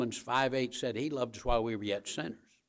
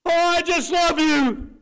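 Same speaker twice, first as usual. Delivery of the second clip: shrill, much higher, louder gushing thank-you; gushing over a gift; 10 dB louder than usual. Retold or imitated. Someone gushing after opening a nice gift, squealing. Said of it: "they go".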